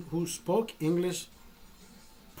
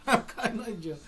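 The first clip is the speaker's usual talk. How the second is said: higher, cracking up at own joke; laughing; 6 semitones higher than usual. Real, not acted. Storyteller repeating his own joke answer, laughing. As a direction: laugh through the words.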